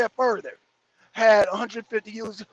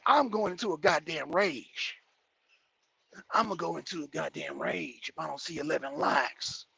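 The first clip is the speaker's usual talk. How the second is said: understated threat; 8 semitones lower, 8 dB quieter than usual. Real, not acted. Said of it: "mock threat of rage".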